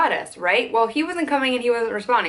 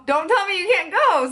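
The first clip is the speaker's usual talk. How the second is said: retold protest; 9 semitones higher than usual. Protesting his last-minute cancellation.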